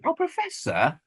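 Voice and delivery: high-pitched whiny imitation; mock whinging. Podcaster imitating Ace whinging at the Doctor, mock whiny. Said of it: "She was whinging".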